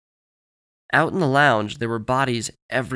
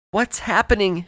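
high, louder panicked cry; 10 semitones higher, 5 dB louder than usual. Acted. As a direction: cry out in alarm. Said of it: "she cried".

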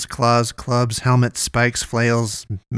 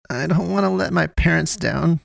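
higher-pitched mild acted sob; sobbing; 6 semitones higher than usual. A narrator's reading. Poe, head in hands on her coffin, sobbing. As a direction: whimper it higher, as if starting to cry. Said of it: "she sobbed".

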